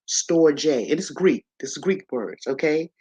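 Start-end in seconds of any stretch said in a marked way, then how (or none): 0.1-0.9 storge in two syllables, "STOR-jay"; storge with final e sounded as "ay"; storge pronounced "stor-jay". Shown as a demonstration. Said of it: "it's pronounced".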